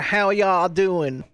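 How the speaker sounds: slow, stretched Southern US drawl. Shown as a demonstration. Demonstrating the accent to chat, Southern drawl. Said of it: "It's more like a".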